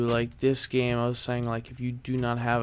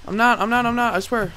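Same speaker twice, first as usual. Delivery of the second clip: scared pleading; 9 semitones higher, 6 dB louder, faster than usual. Real, not acted. Hiding from game monsters.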